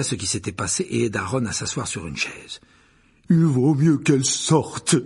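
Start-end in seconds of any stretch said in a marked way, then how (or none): 3.3-5.0 loud, slow, jolly giant; hearty, higher, stretched delivery; cheerful. Acted. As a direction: boom it out merrily, drawn out. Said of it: "dit Hagrid d'un ton joyeux".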